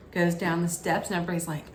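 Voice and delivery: ordinary talk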